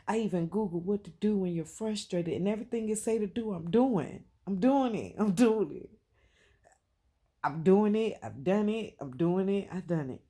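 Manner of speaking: loud, hammering repeats of doing it; loud insistence. Real, not acted. Vlogger to her viewers, venting in loud insistent repeats.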